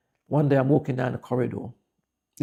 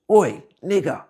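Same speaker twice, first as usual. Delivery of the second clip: loud, higher jeering shout of a schoolboy; shouted slur; 6 semitones higher, 8 dB louder, slower than usual. Retold or imitated. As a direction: bark it across the classroom like a taunting kid. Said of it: "he goes".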